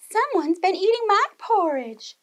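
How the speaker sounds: Mama Bear mourning her porridge, high wail. A narrator's reading. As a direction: wail it like a distressed mother bear. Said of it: "wailed Mama Bear".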